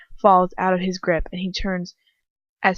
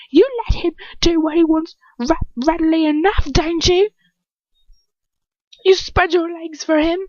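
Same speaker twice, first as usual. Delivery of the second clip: raging villain; 10 semitones higher, 5 dB louder than usual. Acted. Dolohov raging at the girl.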